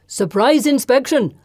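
calling out. A narrator's reading. Runaway to a startled sailor, calling out. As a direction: sing out an official announcement. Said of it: "Kartik calls".

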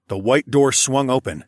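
usual speaking voice

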